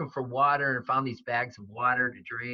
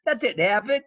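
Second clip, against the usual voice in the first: higher, louder disbelieving blurt; shocked referee; 9 semitones higher, 6 dB louder than usual. Retold or imitated. Referee stunned by the sudden throw, higher, louder disbelief. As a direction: blurt it like you can't believe what you just saw. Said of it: "the ref's in shock".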